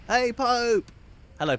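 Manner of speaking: high, loud excited greeting; calling out. Real, not acted. Streamer greets Pope arriving, calling out. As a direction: hail someone with a sudden high shout.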